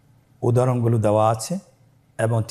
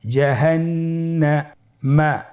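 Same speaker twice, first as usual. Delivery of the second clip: জাহান্নামা slowly, with a gap before মা; syllables separated; 4 semitones higher, much slower than usual. Shown as a demonstration. Teacher builds the word for learners, slow separated syllables. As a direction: hold the last part of জাহান্নামা apart. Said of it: "পৃথক করে আমরা উচ্চারণ করব".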